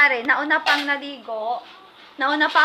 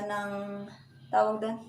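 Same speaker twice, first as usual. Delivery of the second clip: usual speaking voice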